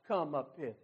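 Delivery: quiet, low come up hither; hushed call of Christ. Acted. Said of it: "that voice cries out".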